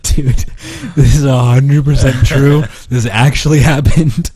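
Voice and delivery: high, loud and incredulous; amused insistence. Real, not acted. Swearing to a girl the story is true, high excited insistence.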